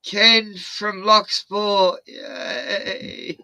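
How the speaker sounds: slow, high, drawn-out cheer; mock elderly cheer. Old folks cheering in front of a car, slow high mock cheer. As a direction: cheer like a hired old fan. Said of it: "and go".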